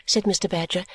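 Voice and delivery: plain reading voice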